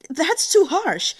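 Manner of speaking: high, loud, slow outcry of protest; crying out. Acted. Protector crying out against harsh punishment, high and loud. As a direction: wail it out in shocked protest. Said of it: "Xu Yanyan cried".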